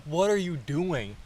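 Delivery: retold appalled outcry; appalled question. Acted shock at bath intruder.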